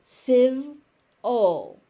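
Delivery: "civ-ul" split into two clear beats; teacher spelling out "civ-ul" slowly; slow syllables. Shown as a demonstration. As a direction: break "civ-ul" apart syllable by syllable. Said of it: "Civil, civil".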